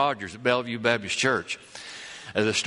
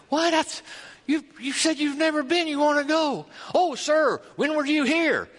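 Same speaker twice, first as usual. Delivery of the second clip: much higher, startled disbelief; shocked church folk; 13 semitones higher than usual. Acted. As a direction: gasp it out as astonished churchgoers. Church people shocked at Lee.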